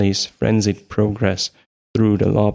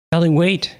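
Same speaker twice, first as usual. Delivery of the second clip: shouting; 8 semitones higher than usual. A narrator's reading. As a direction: shout after her to wait. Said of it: "she shouted".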